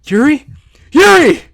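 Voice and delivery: loud, high, frantic calling; urgent shout to hold on; desperate shouting. Acted. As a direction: shout her name in panic. Calling Yuri, calling ambulance.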